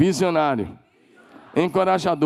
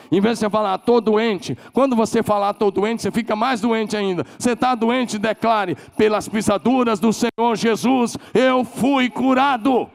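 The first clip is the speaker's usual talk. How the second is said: full-force preaching; 8 semitones higher, 4 dB louder than usual. Real, not acted. Pastor urging the congregation to declare healing, loud and high.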